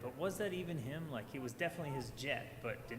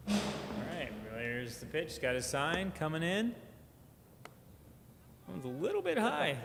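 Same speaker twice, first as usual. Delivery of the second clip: quick play-by-play, rising high on the call; frantic mock sports commentary; 3 semitones higher than usual. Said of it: "do some play-by-play while I figure this out".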